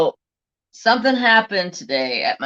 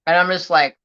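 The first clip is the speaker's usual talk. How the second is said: usual speaking voice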